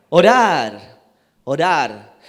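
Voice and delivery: loud, forceful preacher's proclamation; emphatic preaching. Real, not acted. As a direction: hammer the answer home to the congregation. Preacher hammers home the answer.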